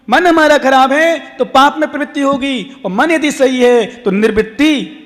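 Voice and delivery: emphatic teaching with lifted phrases; preaching. Real, not acted. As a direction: press each point on the congregation. Preaches at full force.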